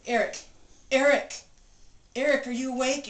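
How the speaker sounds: retold urgent wake-up calls, rising in alarm; calling husband awake. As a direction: shake him awake with your voice. Wife waking sleeping husband.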